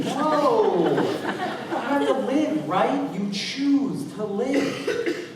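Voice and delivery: man alone with his record, rising to an impassioned cry; emphatic outburst. Real, not acted. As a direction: insist on it like a vow. Impassioned answer to his own question.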